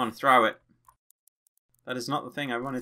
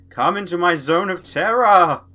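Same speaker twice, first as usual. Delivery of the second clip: loud, raised villainous boast; theatrical taunt; 4 semitones higher, 8 dB louder than usual. Acted. Player lures enemies in, loud theatrical taunt. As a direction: call it out grandly, loud and higher.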